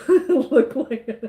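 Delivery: words lost in a high laugh; cracking up mid-sentence. Real, not acted. Bursts out laughing at Gladys.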